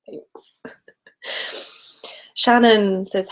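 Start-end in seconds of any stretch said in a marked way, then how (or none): 0.1-0.6 whispered thanks. Real, not acted. Thanking after compliment on hair.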